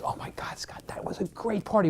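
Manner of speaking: a hushed, breathless gush about the party; retold whisper. Wife in the car to husband, whispering after the party.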